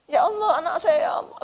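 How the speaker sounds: high-pitched cry to God; acted anguished plea. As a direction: play a parent pleading for a child. Acted parent crying out in afterlife. (meratap memohon, pura-pura panik)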